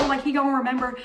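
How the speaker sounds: ordinary delivery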